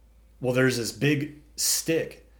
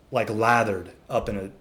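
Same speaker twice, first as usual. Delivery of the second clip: ordinary delivery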